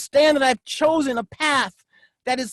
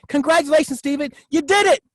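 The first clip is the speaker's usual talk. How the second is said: louder, higher cheer to himself; self-congratulation; 4 semitones higher, 4 dB louder than usual. Shown as a demonstration. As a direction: praise yourself like a proud coach. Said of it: "I congratulate myself all the time".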